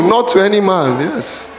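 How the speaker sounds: normal talking voice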